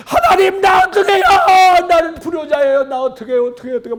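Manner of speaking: high tearful plea to God; crying out. Retold or imitated. Wife weeping before God in the basement, tearful high plea. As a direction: wail it, begging through tears.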